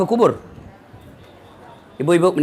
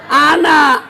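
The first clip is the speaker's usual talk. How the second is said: loud, higher single word; emphatic answer; 8 semitones higher, 6 dB louder than usual. Real, not acted. Preacher calling out the answer to the crowd, loudly. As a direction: call out the answer. Answering his own question.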